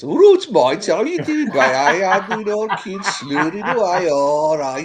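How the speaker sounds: put-on loud, high drunken Midlands accent. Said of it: "I get worse too with my Midlands accent".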